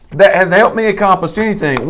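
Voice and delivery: ordinary delivery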